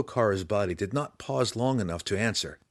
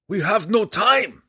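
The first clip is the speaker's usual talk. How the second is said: high, loud shout; urgent cry; 12 semitones higher, 8 dB louder than usual. A narrator's reading. Belwar hurrying his drow friend, crying out. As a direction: cry out against the clock. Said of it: "Belwar cried".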